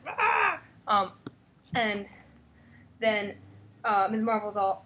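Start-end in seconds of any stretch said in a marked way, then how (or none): 0.1-0.6 high pitched mock scream. Scientists getting hit, high mock scream. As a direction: squeal like a henchman. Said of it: "they're all like".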